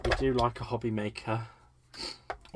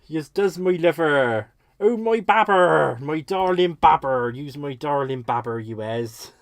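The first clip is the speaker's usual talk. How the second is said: put-on West Country accent; 9 dB louder than usual. Streamer hailing a darling babber, hammed-up West Country accent. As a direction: gush fondly in broad West Country accent. Keeps up accent, greeting someone fondly.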